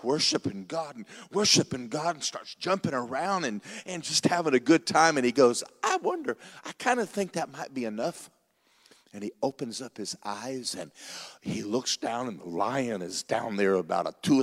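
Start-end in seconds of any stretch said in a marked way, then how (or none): 5.8-8.3 a sudden much higher, squeaky wondering voice; wondering little creature. Acted. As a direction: pipe up high like a tiny creature.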